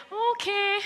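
put-on high little kid's okay; resigned child. Child told no by Mum, high reluctant okay. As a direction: give in as a high-voiced child.

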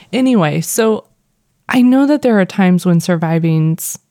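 normal talking voice

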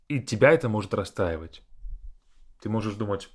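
ordinary delivery

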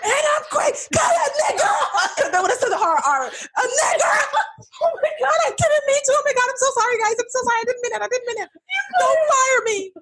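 very high panicked apology. Pretend. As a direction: squeal sorry in a panic.

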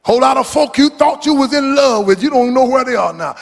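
normal talking voice

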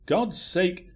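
ordinary delivery

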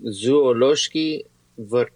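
ordinary delivery

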